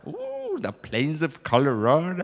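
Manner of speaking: mock-impressed "ooh" and echo. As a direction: parrot the American, pretending to be impressed. Server mockingly echoes American's phrase.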